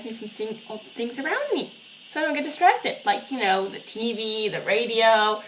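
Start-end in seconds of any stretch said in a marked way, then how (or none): none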